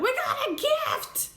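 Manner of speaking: very high, drawn-out excited cheer; gleeful squeal. Retold or imitated. Greeting a delivery of presents, high gleeful squeal. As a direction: squeal it like a kid getting presents.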